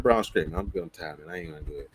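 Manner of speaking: too tired to even argue; weary refusal. Retold or imitated. Refusing a friend who keeps pushing, low and fading.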